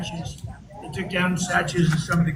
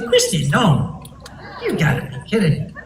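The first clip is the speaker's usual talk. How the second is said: mock disbelief; 2 semitones lower, 3 dB louder than usual. Retold disbelief.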